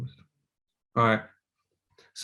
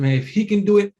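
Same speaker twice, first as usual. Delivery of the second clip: higher and louder, rising to a boast; sudden rush of belief; fired up; 8 semitones higher, 6 dB louder than usual. Retold or imitated. Fired up by the announcement.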